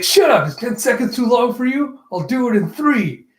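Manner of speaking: high, heated comeback; mock indignant. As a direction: fire back in an acted huff. Acted retort to teasing.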